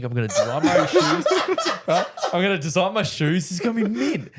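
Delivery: high, giddy replay of big plans; excited. Retold or imitated. Trainee dreaming of designing his shoes, excited. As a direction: gush through laughter, pitch way up. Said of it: "I was really excited and happy".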